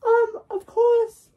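squeaky high character voice; high cartoon voice. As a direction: pitch way up, cartoonish.

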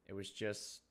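ordinary delivery

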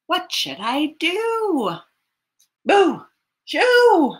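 put-on storyteller shoos the bear for the children; shooing call. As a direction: shout to chase the bear away. Shooing the raccoon.

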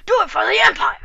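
rally the troops for the Empire; loud quoted Empire battle cry; Star Wars quote. Retold or imitated. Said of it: "Star Wars".

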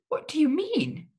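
high, drawn-out question; shocked exclamation. A narrator's reading. Prisoner demanding an explanation from Nerissa, alarmed exclamation. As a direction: ask it slowly in high-pitched alarm. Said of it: "I exclaimed".

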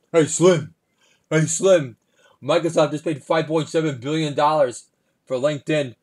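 hammed-up rapper mogul talking deals. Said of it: "impersonation of business merger talk between [name] and [name]".

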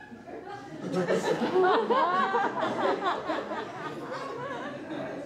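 long giggling laugh; high burst of laughter. Real, not acted. Presenter cracks up at the off-script joke, laughing high.

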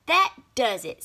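loud, offended huff; fed-up outburst. A narrator's reading. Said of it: "said Half-Baked Bean in a huff".

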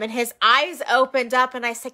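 ordinary delivery

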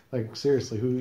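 ordinary talk, nothing marked

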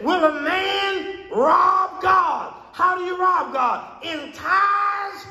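slow, high, ringing pulpit cry; full-force preaching. Real, not acted.

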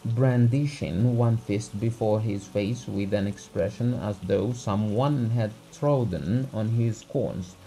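plain reading voice

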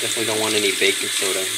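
fast, loud blurt of any baking soda; rushed and loud. Real, not acted. Flute teacher warns students about baking soda, rushed and loud. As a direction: tumble the words out fast and loud, too quickly.

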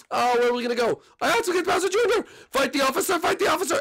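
high, frantic escape voice; high, urgent and panicking during the jailbreak; panicked prisoner. Acted. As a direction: scramble to get everyone out.

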